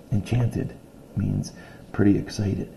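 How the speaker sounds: reading a picture book aloud to a class; steady read-aloud storytelling voice; story narration. A narrator's reading. Teacher reading a story to her class, read-aloud voice.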